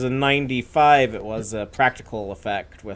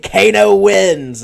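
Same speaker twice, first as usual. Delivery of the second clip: slow, loud, higher announcer boom; movie announcer; 6 semitones higher, 9 dB louder, much slower than usual. Retold or imitated. Quoting the movie line.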